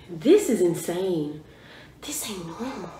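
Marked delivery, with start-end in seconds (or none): 2.0-2.9 breathless whisper, stress on This; hushed in disbelief; awed whisper. Real, not acted. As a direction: whisper This ain't normal, leaning on This. Stunned at the song.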